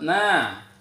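ordinary delivery

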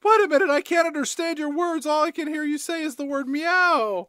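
high, hammed-up alarm; mock panic. Crystal unable to understand her cat, mock panicked.

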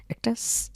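voiceless hiss on স. Shown as a demonstration. Teacher hissing স for viewers. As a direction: whisper a sharp s. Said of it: "এই আওয়াজটা আসবে".

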